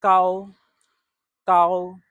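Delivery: Gao held high and steady, tone one; 高，第一声; Mandarin tone one. Shown as a demonstration. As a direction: sing Gao on a high flat pitch. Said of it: "tone one".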